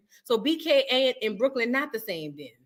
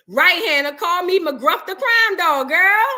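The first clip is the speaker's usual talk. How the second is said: loud, higher hammed-up bravado; mock tough cop; 5 semitones higher, 7 dB louder, slower than usual. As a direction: play the crime-fighter, half joking.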